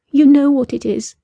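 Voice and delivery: high strained answer. A narrator's reading. Captive woman answering her captor, strained and fearful. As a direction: answer through fear.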